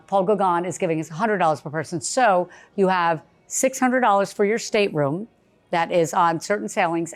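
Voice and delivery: normal talking voice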